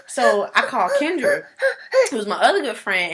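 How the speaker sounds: laughing cry. Real, not acted. Emotional retelling.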